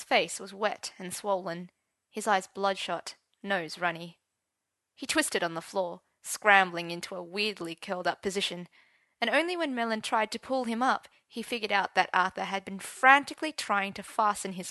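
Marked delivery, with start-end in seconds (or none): none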